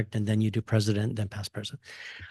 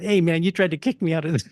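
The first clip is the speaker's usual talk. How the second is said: ribbing tease; 5 semitones higher, faster than usual. Retold or imitated. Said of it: "I kept ribbing him".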